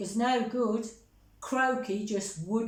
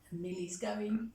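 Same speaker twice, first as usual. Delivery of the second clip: ordinary delivery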